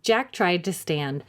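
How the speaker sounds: ordinary delivery, nothing marked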